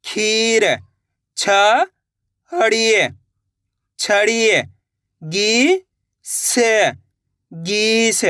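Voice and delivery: each syllable held long, then the joined word; sounding out syllables. Shown as a demonstration. Teacher models Hindi reading for learners to repeat, syllable by syllable. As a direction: sound it out slowly, stretching every vowel, with pauses between. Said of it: "direct मात्रा का उच्चारण करके ही पढ़".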